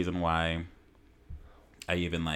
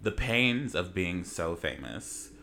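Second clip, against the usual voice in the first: normal talking voice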